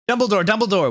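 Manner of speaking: high urgent call; mock-frantic yell. A narrator's reading. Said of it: "we both yelled".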